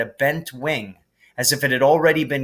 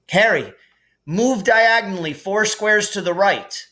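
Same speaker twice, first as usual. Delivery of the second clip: commanding; 8 semitones higher, 3 dB louder than usual. A narrator's reading. Ron directing chess moves.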